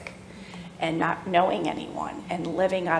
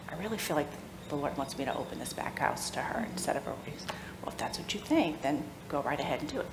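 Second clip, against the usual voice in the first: hushed retelling; 4 semitones lower, 10 dB quieter than usual. Retold or imitated. Retelling talk with husband.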